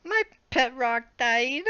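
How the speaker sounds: high, hammed-up mournful voice; high, pitiful mock grief; mock sad. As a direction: confess it with fake heartbreak. Reader's tearful line in fanfic.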